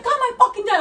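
high-pitched mock order; imitated yelling. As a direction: demand it like a worked-up friend. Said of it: "this bitch fucking starts yelling at me".

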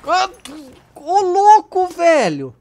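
loud, high amazed cry; excited shout. Real, not acted. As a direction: shout in disbelief. Wild moment in the game.

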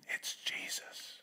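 slow hushed whisper of recognition; awed whisper. Retold or imitated. John realizing to the disciples, slow awed whisper. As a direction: breathe it out in wonder.

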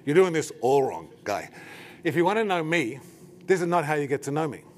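blunt, exaggerated telling-off; mock-mean scolding. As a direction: imitate someone sternly setting a fan straight. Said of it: "this is what she meant".

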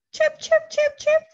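high, chirpy birdsong imitation; bird chirps. Mom imitating birds for her toddler, high chirping. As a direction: chirp like a little bird for the baby.

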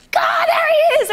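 high, drawn-out ecstatic yell; loud, soaring fan scream; excited shout. Retold or imitated. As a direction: cry out at spotting your idol. Retold sighting of her idol overhead.

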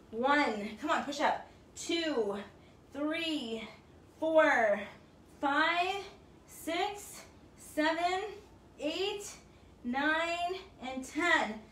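energetic workout countdown; coach counting reps. Acted. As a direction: call the count out like a fitness coach. Coach counting push-ups.

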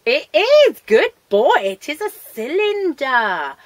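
loud, high, emphatic praise; delighted, booming well-done; excited praise. Real, not acted. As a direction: make a big fuss of a good dog. Praising the pet.

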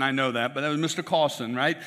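usual speaking voice

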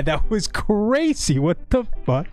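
normal talking voice

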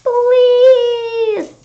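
high, loud drawn-out plea; begging. Acted. Kid begging the monster, high desperate plea. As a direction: beg desperately.

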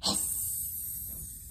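long, unvoiced monster hiss; drawn-out spooky hiss; hissing sound. A narrator's reading. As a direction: let out a long, horrible hiss. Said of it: "a horrible hissing sound filled the air".

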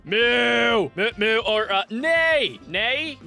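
loud mock moo and neigh; mating call. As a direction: animal calls, half joking. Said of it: "trying to do a mating call".

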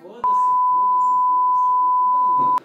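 loud, high, drawn-out cry of disbelief; stunned high shout. Real, not acted. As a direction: yell it out in shock. Reacts to pregnancy news shouting.

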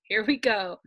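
ordinary delivery, nothing marked

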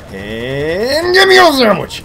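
slow windup into a high yell; mock battle yell. Gamer flanking the enemy cluster, drawn-out high mock yell. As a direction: draw out the first word, then shout high. Demonstrates his knife catchphrase.

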